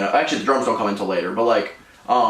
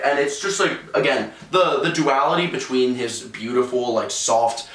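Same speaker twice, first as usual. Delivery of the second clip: usual speaking voice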